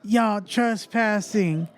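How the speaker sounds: imitating the angry mom. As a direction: lay down the law like the resident mom. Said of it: "her mom came back, and she's like".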